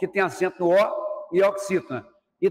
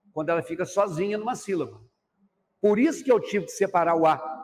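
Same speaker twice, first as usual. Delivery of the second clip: normal talking voice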